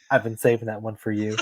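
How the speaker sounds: a softly dropped, low deadpan quote; low-key quoted one-liner. Retold or imitated. Quoting her movie one-liner.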